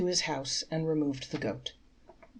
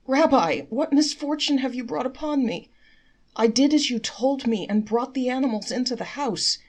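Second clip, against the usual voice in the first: high, loud desperate pleading to the rabbi; crying out in despair; 8 semitones higher, 7 dB louder than usual. Acted. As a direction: wail it, begging for rescue. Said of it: "returned to the rabbi and cried".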